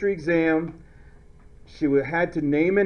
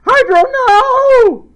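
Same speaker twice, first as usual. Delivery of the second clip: high, loud drawn-out scream; over-the-top wail on the long no; screamed mnemonic; 10 dB louder, slower than usual. Shown as a demonstration. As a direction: burst into a comic shriek. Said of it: "so you go".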